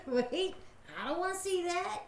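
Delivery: high, squeamish retold protest; mock horror. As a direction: beg off in comic alarm. Retold protest at mom undressing.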